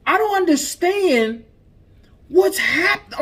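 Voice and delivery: high-pitched, incredulous outrage; appalled, voice riding high; real indignation. Real, not acted. Host reacts to child being shot.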